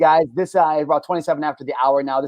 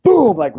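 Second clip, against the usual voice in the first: punch sound; 7 semitones higher, 5 dB louder than usual. Retold or imitated. Punch in a fight story.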